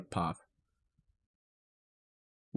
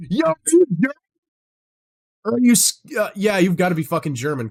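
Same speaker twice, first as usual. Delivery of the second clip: much higher and louder, hyped shout; excited outburst; 9 semitones higher, 8 dB louder than usual. Real, not acted. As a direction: burst out with real excitement at the guess. Excited guess at chat's country.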